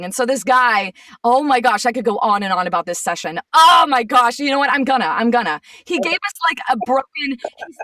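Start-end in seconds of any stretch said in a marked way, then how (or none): 3.5-5.6 exasperated. Real, not acted. Exasperated recall of session.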